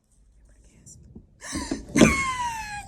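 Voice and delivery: high, loud gasp into a shriek; horrified gasp and scream. Acted. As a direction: gasp and squeal in mock horror. Ghost sees she changed colour.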